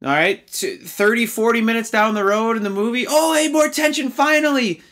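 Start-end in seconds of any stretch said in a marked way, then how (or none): 3.1-4.8 high-pitched sarcastic burst of relief; mock excitement. Reviewer mocking a brief moment of tension, in mock excitement. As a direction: exaggerate the thrill, pitched way up.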